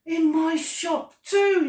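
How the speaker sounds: high, loud, drawn-out yell; excited yell. A narrator's reading. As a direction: yell it slowly in disbelieving excitement. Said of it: "he yelled".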